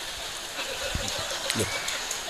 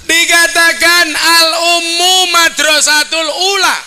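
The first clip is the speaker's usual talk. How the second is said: ceramah berapi-api; 6 semitones higher than usual. Acted. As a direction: drive the point home to the crowd. Full sermon delivery. (ceramah penuh semangat dengan suara tinggi dan keras)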